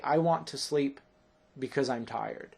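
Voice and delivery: misplaced break between "sleep" and "because"; wrong comma pause. Shown as a demonstration. Teacher showing what we don't say, wrongly paused. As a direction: stop after "sleep", then drop into "because I'm tired". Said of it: "We don't say".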